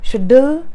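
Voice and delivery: SCHEDULE as "shuh-DULL"; mispronunciation. Shown as a demonstration. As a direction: say "schedule" with a short DULL ending. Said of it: "As long as you don't say".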